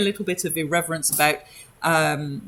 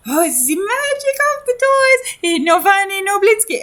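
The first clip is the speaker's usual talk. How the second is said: very high squeaky showman voice; Blinsky's toy voice; 6 dB louder than usual. Acted. Toymaker Blinsky showing off toys to children, very high squeaky delight. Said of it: "stop for a little while and go".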